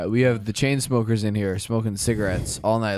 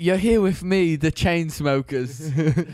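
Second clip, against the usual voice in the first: mock British radio intro, higher at first; British accent; 4 semitones higher, slower than usual. Band member introducing the Chainsmokers to listeners, mock British accent. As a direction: announce the band in slow, hammed-up British. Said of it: "I thought they were British".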